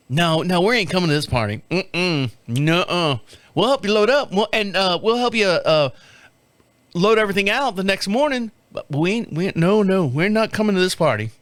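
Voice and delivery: lightly voiced Tennessee twang, firm refusal; mock Tennessee woman saying no way. Neighbor's wife firmly declining the party, Tennessee twang. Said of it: "she's got a little twang to her".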